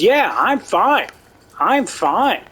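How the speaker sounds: high, slow, put-on huffy voice; guard acting offended, huffy. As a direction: sound insulted and brush it off. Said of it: "acts offended".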